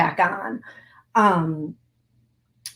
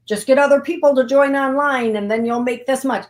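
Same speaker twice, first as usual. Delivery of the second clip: higher, louder hyped-up recruiter voice; mock MLM pitch; 5 semitones higher, 5 dB louder than usual. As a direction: parody a pushy online-scheme salesperson. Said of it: "No, it is not an M L M".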